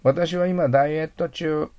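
ordinary talk

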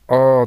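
loud, long r-coloured あー, as in English "term"; drawn-out vowel demo. Shown as a demonstration. Teacher showing students the vowel in term, drawn-out vowel demo. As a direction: hold あー loudly with the English "er" sound. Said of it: "あーという発音は".

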